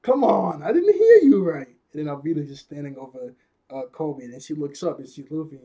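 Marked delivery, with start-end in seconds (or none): 0.0-1.7 loud, high demand with a stretched word; mock outraged yell. Alvida challenging her crewman's answer, mock outraged yell. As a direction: yell it high, playing the character. Acted demand in retold scene.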